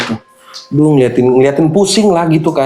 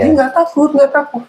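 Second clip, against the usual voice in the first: put-on childish spirit voice; 13 semitones higher than usual.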